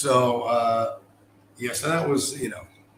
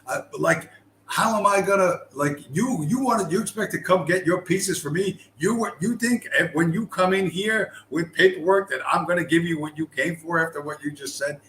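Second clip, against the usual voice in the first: imitating her furious rant, higher and louder; mock outrage; 6 semitones higher, 3 dB louder than usual. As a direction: ham up the indignant clerk chewing him out. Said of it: "flips out".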